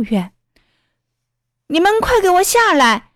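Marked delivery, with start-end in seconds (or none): 1.7-3.1 put-on high, urgent call to kids; acted alarmed command; loud shouted order. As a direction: shout up to them, pitch well raised. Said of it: "他大声喊".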